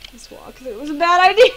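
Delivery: real exasperation breaking into laughter; exasperated outburst. Real, not acted. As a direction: swell to a loud cry, about to laugh. Laughing despair at own walkthrough.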